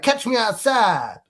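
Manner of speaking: high, slow put-on American girl accent; mock a teen American girl meme voice, slowly. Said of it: "They go like".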